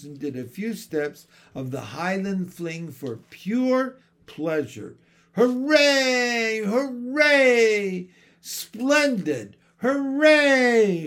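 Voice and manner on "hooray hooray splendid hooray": high, loud, gleeful cheers; shrill cheering. A narrator's reading. Old woman dancing a fling, cheering shrilly. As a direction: whoop for pure pleasure while dancing. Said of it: "she cried in her shrill little voice".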